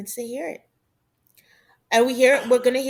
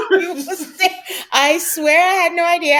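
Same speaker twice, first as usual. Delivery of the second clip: laughing; 7 semitones higher, 9 dB louder than usual. Real, not acted.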